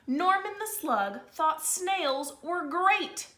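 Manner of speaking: put-on lively read-aloud to kids; storybook reading. A narrator's reading. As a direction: read it like a picture book to the class. Held story narration for children.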